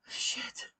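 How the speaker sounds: soft unvoiced swear; whispered curse. Real, not acted. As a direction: breathe the curse out. Whispered curse under breath.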